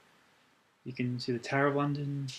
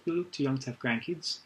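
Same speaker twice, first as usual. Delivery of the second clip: usual speaking voice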